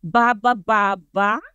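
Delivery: "Ba, ba, ba, ba" with a rising question lilt; questioning ba-ba-ba-ba chant; Filipino ba inflection. Shown as a demonstration. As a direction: lead the audience in "Ba, ba, ba". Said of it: "say these syllables with this inflection".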